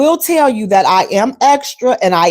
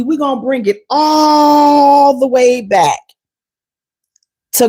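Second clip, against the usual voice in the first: emphatic shout; 3 semitones higher, slower than usual. Real, not acted. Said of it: "insisting on greetings".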